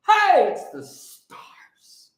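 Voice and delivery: slow hushed whisper. Real, not acted. Marvelling at the stars on the flag, a slow whisper. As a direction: breathe it out in awe.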